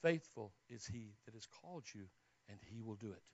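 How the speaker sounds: low, soft, measured scripture; solemn hush. Retold or imitated. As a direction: speak it as words heard in prayer. Words heard from God, hushed.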